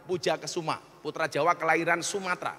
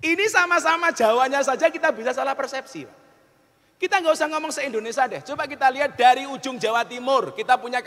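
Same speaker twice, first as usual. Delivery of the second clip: raised, emphatic sermon voice; forceful preaching; 7 semitones higher, 6 dB louder than usual. Real, not acted. Preacher warning listeners about misperception, forceful preaching. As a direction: drive the point home to the crowd.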